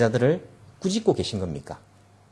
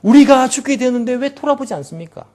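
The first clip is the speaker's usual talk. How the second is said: much higher, aggrieved protest; resentful complaint; 10 semitones higher, 3 dB louder than usual. Retold or imitated. Disciples waking Jesus, resentful and pleading. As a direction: rail at him for not caring. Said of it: "원망과 불평 섞인 그런 감정".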